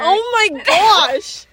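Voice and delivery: high, loud, drawn-out exclamation; shocked outburst. Real, not acted. Reacting to a friend's word for her, shocked. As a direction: exclaim it long and loud in disbelief. Reacts to being called eager.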